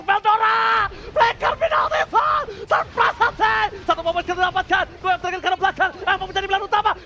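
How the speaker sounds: screaming the game-winning fight; shouting play-by-play. Acted. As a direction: yell every kill as it lands. Final teamfight casting.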